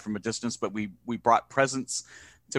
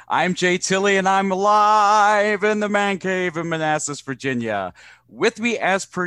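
booming announcer intro; 6 semitones higher, 7 dB louder than usual. Acted. Host introduces himself announcer-style.

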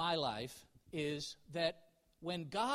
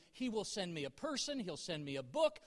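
ordinary talk, nothing marked

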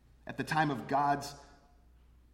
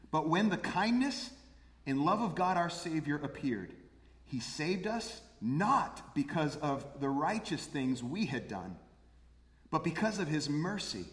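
solemn Bible reading, a little louder; scripture reading; 3 semitones higher, 4 dB louder than usual. Acted. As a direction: read the verse out to the congregation. Reads scripture aloud.

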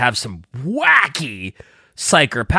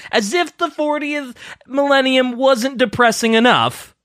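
ordinary talk

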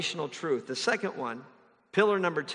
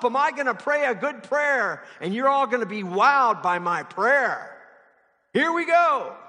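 loud, high, hammed-up showman; mock boastful performer hyping the crowd; showoff prayer performer; 6 semitones higher, 5 dB louder than usual. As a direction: announce it like a circus act. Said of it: "prayer was a performance".

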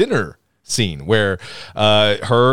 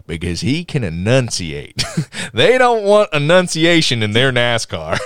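higher opening, slowing into the punchline; Jeff Foxworthy; 6 semitones higher, 3 dB louder than usual. Retold or imitated. Comedian stealing a joke for the friends, hammed-up Southern comic. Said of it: "I'm gonna steal a Jeff Foxworthy joke".

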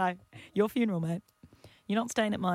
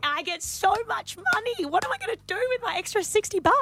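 excited; 12 semitones higher than usual. Real, not acted. Excited about the money.

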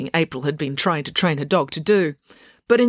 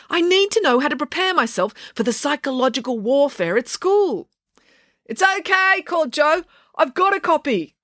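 calling out; 9 semitones higher than usual. A narrator's reading. Calling across the lawn.

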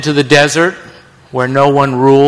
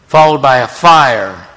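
ordinary talk

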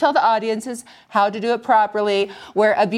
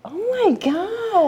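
high, slow amazed sigh; 10 semitones higher, much slower than usual. Real, not acted. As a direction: draw out "Oh my gosh" in wonder. Amazed by the ritual.

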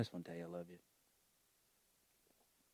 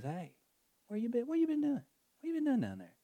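much higher teasing voice of the Lord; the Lord, playful. Retold or imitated. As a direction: greet a child home with a high playful call. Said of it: "And the Lord says".

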